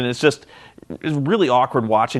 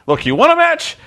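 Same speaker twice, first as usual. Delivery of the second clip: mock Bischoff; 13 semitones higher, 4 dB louder than usual. Bischoff voiced in a retold scene.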